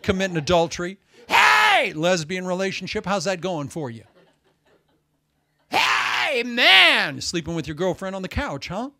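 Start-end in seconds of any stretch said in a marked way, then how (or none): 1.3-1.9 very high, loud hammed-up church shout; mock hypocrite hollering in church; mock worship whoop. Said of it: "pray the loudest in the service". 5.7-7.2 high, loud hammed-up holler; mock hypocrite shouting praise; mock worship shout. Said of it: "shout the loudest".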